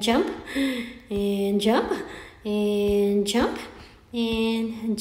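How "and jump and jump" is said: ordinary delivery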